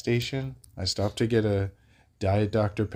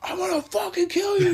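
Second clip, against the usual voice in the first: extremely high screechy imitation; mock screaming. Crackhead suddenly threatening after a lucid apology, screeched mock scream. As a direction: flip into a squealed threat, far above normal pitch. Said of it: "say that shit lucid, and then be like".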